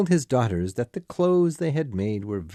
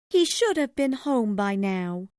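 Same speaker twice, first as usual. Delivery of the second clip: high-pitched mother character, fretting; high, anxious mother's voice; worried mother; 3 dB louder than usual. A narrator's reading. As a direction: voice a waiting mother, much higher than narration. Narrator voices worried Mrs Cratchit.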